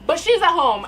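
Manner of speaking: ordinary talk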